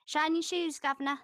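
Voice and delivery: high, loud caricature British accent; mock British accent. Shown as a demonstration. Streamer joking about authentic British accents, mock British caricature. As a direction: call it out in a stock British street accent. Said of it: "Authentic British accent".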